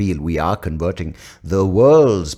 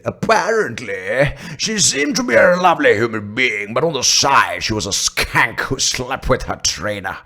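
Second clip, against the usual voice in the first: confident Indian accent; 5 semitones higher than usual. Shown as a demonstration. Gossip about a skank and her trainer, confident Indian accent. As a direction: pronounce it with conviction in an Indian accent. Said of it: "conviction of confidence that the Indian accent".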